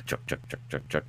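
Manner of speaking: whispered rhythmic engine chug; truck impression. Shown as a demonstration. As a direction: chug the word softly, over and over. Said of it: "again, truck".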